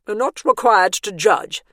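a hint of a stern matron. A narrator's reading. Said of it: "said matron sternly".

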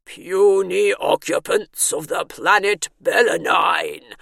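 loud, slow alien overlord; alien-sounding voice. A narrator's reading. Alien bursts into Bernice's head, proclaiming loudly. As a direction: proclaim it slowly like a booming alien. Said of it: "burst an alien-sounding voice inside her head".

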